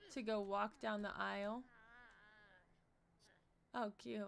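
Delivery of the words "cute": usual speaking voice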